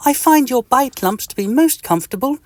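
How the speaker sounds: high, cheery tiny-creature greeting; friendly little itch. Acted. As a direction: greet your neighbour brightly and squeakily. Mister Itch voice.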